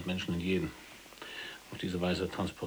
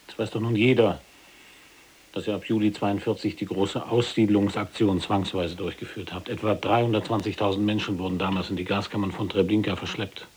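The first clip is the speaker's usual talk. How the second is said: loud, indignant outburst; furious, raising the voice at the general; angry accusation; 7 dB louder than usual. Acted. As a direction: throw the facts in his face.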